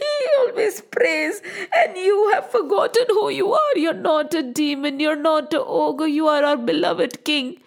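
high, fast, tearful pleading; high, crying wife begging the ogre. Acted. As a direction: beg through mock tears. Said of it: "she kept on crying and saying".

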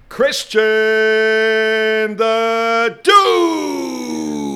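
loud, high, hyped-up guest intro; hype announcer. Acted. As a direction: announce him like a fight champ.

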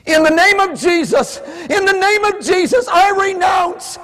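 high, loud fervent pleading; full-force preaching prayer. Real, not acted. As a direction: pour it all out to God at the top of your voice.

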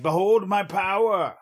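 high, loud, slow proclamation; pompous booming ogre mage. Pretend. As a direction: boom it out like a pompous sorcerer. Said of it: "And I'd be like".

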